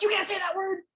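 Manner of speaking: high, loud, laughing mock scold; teasing a friend who slipped, laughing mock outrage. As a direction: scold him in jest, cracking up. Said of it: "I'd be like".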